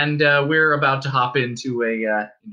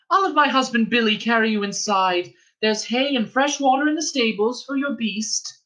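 friendly innkeeper's wife; 11 semitones higher than usual. Acted. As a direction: coax the girl in from the rain. Innkeeper's wife gives orders.

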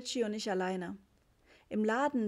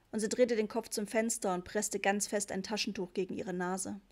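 ordinary delivery

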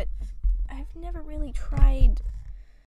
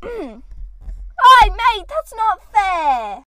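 put-on loud, high, drawn-out Australian-accent complaint; mock Australian protest; 5 semitones higher, 10 dB louder, much slower than usual. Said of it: "trying to do that dumb accent".

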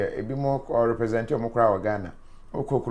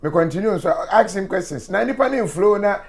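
excited laughing retelling; 6 semitones higher, 6 dB louder than usual. Retold or imitated. Host retells own reply, laughing, excited.

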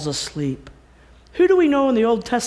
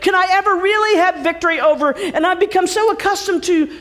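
raised, quick anxious pleading; doubting believer; 7 semitones higher, 4 dB louder, faster than usual. Retold or imitated. Preacher voicing a believer stuck in habits. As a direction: voice a torn listener's doubts. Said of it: "voicing a doubting believer".

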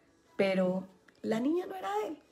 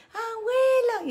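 put-on much higher child's call of grandma; 13 semitones higher, much slower than usual. Little daughters greeting their grandmother, high child call. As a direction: squeal it like a little girl.

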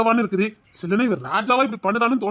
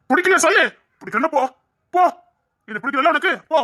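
angry outburst; 7 semitones higher than usual. Acted. Husband's angry outburst at wife.

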